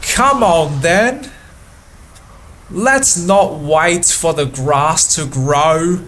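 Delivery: put-on lightly done thick British bus driver accent; a mild attempt at a rough British accent. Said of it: "thick British accent, which sounded roughly like".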